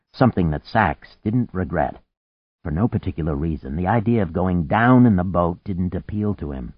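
ordinary delivery, nothing marked

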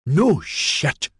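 high, scornful retort; spat out. A narrator's reading. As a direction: spit it at her sarcastically. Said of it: "Maxon spat at her".

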